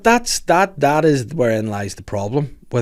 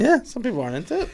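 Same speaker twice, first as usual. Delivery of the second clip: high, fast put-on voice; 12 semitones higher, faster than usual. Host restating his point, high and quick in a put-on voice. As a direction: repeat it in a high silly voice. Repeats in odd voice.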